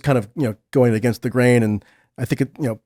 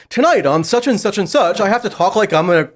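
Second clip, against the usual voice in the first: higher, exaggerated TV news intonation; mock newscaster; 5 semitones higher than usual. Panelist mocking local reporters' intonation, hammed-up newscaster voice. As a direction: put on weird broadcast vocal lilts. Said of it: "With weird vocal intonations, like".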